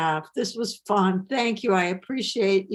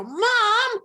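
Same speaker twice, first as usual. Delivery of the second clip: put-on high, drawn-up kid's complaint; protesting child; 11 semitones higher, 4 dB louder than usual. Child called in from play protests to mother, high complaining voice. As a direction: plead like a put-upon kid, pitched way up.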